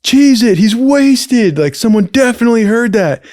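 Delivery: high, loud panicky whisper-shout of scared friends; panicked buddies. Retold or imitated. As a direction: squeal it out as the scared buddies bolting. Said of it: "the two other dudes were all like".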